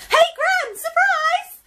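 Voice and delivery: high, loud cheerful surprise call; excited shout. Acted. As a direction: burst in calling out. Girl bursts in on Gran.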